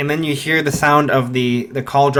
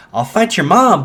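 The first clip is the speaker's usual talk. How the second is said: brash offer; 6 semitones higher, 6 dB louder than usual. Acted.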